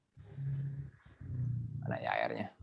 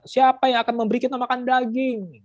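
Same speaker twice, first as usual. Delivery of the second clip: whining cry; 11 semitones higher, 4 dB louder than usual. Acted. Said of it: "menangis pula berkata".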